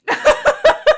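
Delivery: high shrieking laugh; loud burst of laughter. Real, not acted. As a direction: crack up loudly. Laughing at the matching note.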